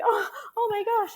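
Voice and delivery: high, overcome exclamation; emotional gasp. Retold or imitated. Wife recalling the moment she started crying, high overcome exclamation. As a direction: choke it out, overwhelmed. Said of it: "and then I started crying".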